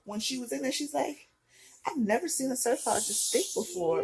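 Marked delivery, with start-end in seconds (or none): 1.8-4.0 high-pitched imitated disbelief; astonished. Other doctor examining her stitch, mock astonished.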